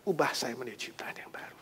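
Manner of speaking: soft, whispered plea to God; wife whispering a prayer to God. Acted. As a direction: pray it under your breath.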